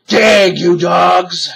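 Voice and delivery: shouted. A narrator's reading. Captain orders his pirates to dig, angry shout. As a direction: bark the order at lazy crew. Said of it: "cried Captain Bones".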